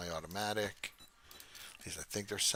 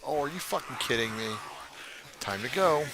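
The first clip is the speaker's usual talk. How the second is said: alarmed outburst; 3 semitones higher, 4 dB louder than usual. Real, not acted. Caught out of ammo.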